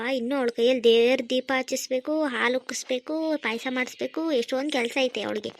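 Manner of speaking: normal talking voice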